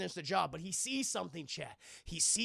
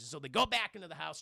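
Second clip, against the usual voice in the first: usual speaking voice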